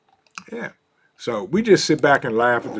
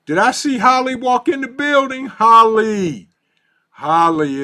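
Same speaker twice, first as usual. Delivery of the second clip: excited greeting; 10 semitones higher, 8 dB louder than usual. Real, not acted. Greeting a viewer who just joined.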